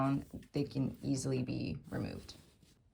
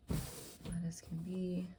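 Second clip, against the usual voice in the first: whisper; 8 dB quieter, slower than usual. Real, not acted. Smoothing fabric, whispered aside.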